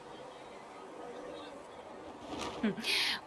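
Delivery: a quiet breathy chuckle; soft laugh. Acted. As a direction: laugh softly, pleased by the answer. Spymaster amused by harsh remark.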